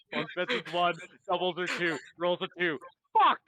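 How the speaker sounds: laughing at his own bad roll; laughing retell. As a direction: blurt it out through laughter. Player retells own dice thought.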